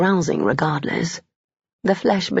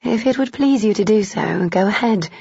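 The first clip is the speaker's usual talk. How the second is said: higher female voice; 4 semitones higher than usual. A narrator's reading. Heroine replies.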